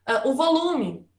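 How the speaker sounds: usual speaking voice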